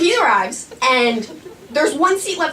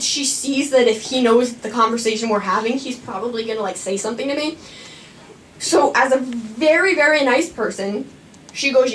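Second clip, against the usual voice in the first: usual speaking voice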